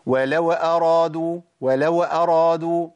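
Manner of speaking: "ولو أرادوا" with the sakin waw given a vowel, slow; wrongly voweled waw. Shown as a demonstration. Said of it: "تحريك الواو".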